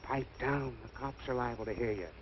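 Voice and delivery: low, soft hushing warning; hushed warning. Acted. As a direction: hush them so the cops won't hear. Conman hushing them.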